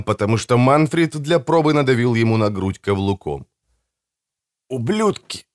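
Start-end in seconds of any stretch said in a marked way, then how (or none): 4.7-5.5 higher, strained, effortful curse; strained dying curse. A narrator's reading. Dying Bertram finally curses the brothers, strained. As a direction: force the whole word out with failing strength.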